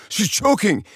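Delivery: high-pitched panicked cry, imitated; mock panic, voice leaping up; alarmed dad. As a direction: raise the alarm as a frightened husband. Dad alarmed in choking story.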